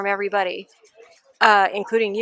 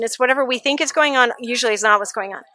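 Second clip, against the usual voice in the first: normal talking voice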